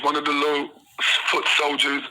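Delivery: ordinary delivery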